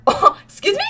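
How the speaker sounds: high, indignant mock disbelief; offended. Teller acts offended after friend's insult, high mock indignation. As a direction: play it scandalised.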